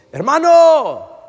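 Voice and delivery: high, drawn-out holler; booming long shout of greeting; calling out loudly. Retold or imitated. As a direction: call to someone two lines away. Man calls across checkout lines.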